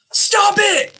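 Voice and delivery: sudden high yell, far above normal; shouted stop it. Retold or imitated. Psychiatrist yells at his patient across the desk, sudden high shout. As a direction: blurt it out hard, like snapping someone out of it. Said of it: "he yells across the table".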